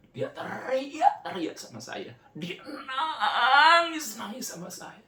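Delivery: real crying, very slow and high-pitched; voice breaking. Real, not acted. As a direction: fight through tears while telling it. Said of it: "Nggak kuat, saudara". (suara pecah menangis, sangat lambat)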